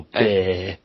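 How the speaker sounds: drawn-out mimicked accent on Obey; mocking accent. Teasing a friend over how he says Obey, mocking accent. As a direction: stretch Obey out, imitating his accent. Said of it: "don't make fun of my accent".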